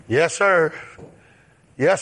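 usual speaking voice

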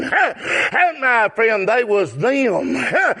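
normal talking voice

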